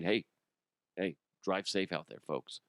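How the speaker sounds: soft, low, kindly send-off; mild friendly reminder. Acted. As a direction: say it gently, dropping low. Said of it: "Or he's just saying".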